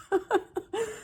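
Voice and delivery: a high, choked little laugh through tears; teary high laugh. Real, not acted. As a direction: laugh while fighting back tears. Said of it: "tearing up".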